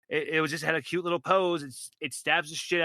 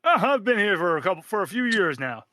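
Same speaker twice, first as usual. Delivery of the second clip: higher, louder and fast; hurried friendly NPC; 5 semitones higher, 5 dB louder, faster than usual. Acted. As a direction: rush out the warning cheerfully. DM plays Marble NPC warning.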